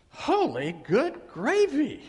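ordinary talk

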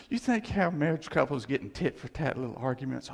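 normal talking voice

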